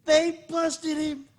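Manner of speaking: high, loud, slow they busted him; friend's dramatic report of the bust; mock alarm. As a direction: announce it like big breaking news. Retelling friend's text dramatically.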